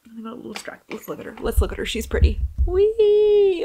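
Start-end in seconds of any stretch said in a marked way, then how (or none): 2.6-3.6 high playful squeal; a playful high whee; gleeful whee. Real, not acted. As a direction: squeal with delight. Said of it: "showing off her tree".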